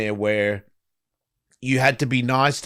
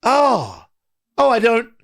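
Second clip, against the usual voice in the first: inward groan; 9 semitones higher, 4 dB louder, slower than usual. Retold or imitated. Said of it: "it made me go".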